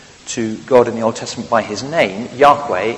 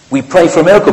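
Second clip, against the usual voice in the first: raised, louder headline cry; mock headline proclamation; 5 semitones higher, 9 dB louder, much faster than usual. Preacher mock-proclaiming the football headline, raised and loud. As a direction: announce it like a tabloid front page.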